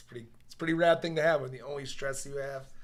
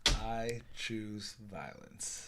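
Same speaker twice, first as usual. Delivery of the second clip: very slow, softer, drawn-out; mock-solemn declaration; 2 semitones lower, 5 dB quieter, much slower than usual. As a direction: stretch each word like a joking verdict. Listener's mock-solemn catchphrase.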